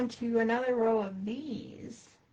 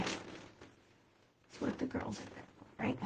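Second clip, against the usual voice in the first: slow whisper; 14 dB quieter, slower than usual. Real, not acted. Muttering while working.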